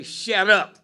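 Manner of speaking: a sudden loud shout of shut up; mock angry shout. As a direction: bark it out loud like a fed-up parent. Parent snapping at crying child.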